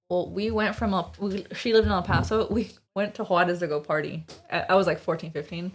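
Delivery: ordinary talk, nothing marked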